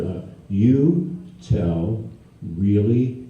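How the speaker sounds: very slow, word by word; slowly. Retold or imitated. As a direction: drag it out carefully so he understands. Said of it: "Now, slowly".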